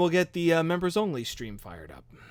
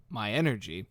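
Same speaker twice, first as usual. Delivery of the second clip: ordinary talk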